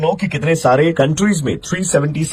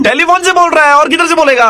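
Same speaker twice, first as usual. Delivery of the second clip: high, loud indignant complaint; annoyed pretend caller; 14 semitones higher, 8 dB louder than usual. Prank caller loudly scolding the woman for not showing up, high and loud. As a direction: scold her like a fed-up friend waiting.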